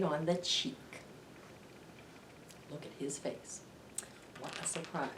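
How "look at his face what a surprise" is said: breathy hushed voice, almost whispered; soft secret aside. Acted. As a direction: lean in and hush the kids.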